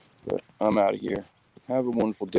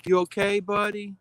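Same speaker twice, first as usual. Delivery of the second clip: higher, gentle voice for the dog; pet talk; 11 semitones higher than usual. Real, not acted. Talking to the dog.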